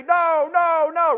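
high, loud imitated scream of a possessed girl; retold screaming. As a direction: shout it back like she did in the video. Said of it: "she would say".

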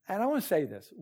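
ordinary talk

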